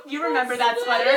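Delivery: loud, laughing through the question; half laughing it out. Real, not acted. Rereads line while laughing.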